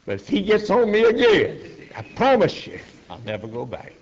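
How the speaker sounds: stubborn threat. Retold or imitated. Angry man vows never to return.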